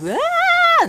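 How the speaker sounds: sudden high shrieking cry; mock wail. Shown as a demonstration. Vlogger showing the wrong kind of crying, a high mock wail. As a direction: burst into a fake wail like a crazy person. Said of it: "No. Not like that".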